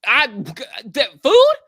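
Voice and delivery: can't believe she passed on food; incredulous. Real, not acted. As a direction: drag it out in shocked disbelief. Incredulous about refusing food.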